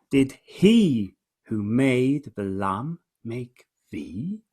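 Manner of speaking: very slow, long stressed "he"; slow questioning verse with weight on "he"; pointed question. Shown as a demonstration. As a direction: draw out "he" and separate "make thee". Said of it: "he's not asking indirectly".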